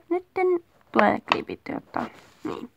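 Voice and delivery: ordinary talk, nothing marked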